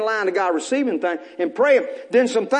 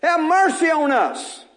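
lepers crying out; 6 semitones higher, slower than usual. Acted. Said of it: "they cried out".

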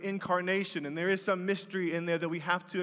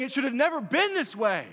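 higher, louder cry that it should not be; anguished lament; 6 semitones higher, 5 dB louder than usual. Retold or imitated. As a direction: voice Jesus grieving at the tomb.

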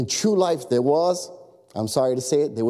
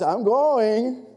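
higher, drawn-out protest with a lift; exasperated preteen; 7 semitones higher than usual. Retold or imitated. Preteen daughter answering her nagging parent, exasperated. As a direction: imitate a preteen putting Dad off. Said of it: "get on my last nerve".

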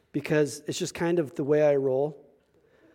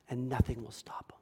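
hushed, unvoiced whisper; whispered promise to the congregation; 4 semitones lower, 10 dB quieter than usual. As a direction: breathe it out like a secret. Preacher drops to a hush.

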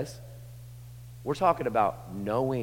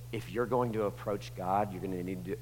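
hushed lecturing; 3 semitones lower, 7 dB quieter than usual. Acted. Crowd hushing the blind men.

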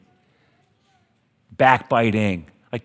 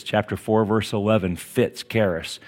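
ordinary talk, nothing marked

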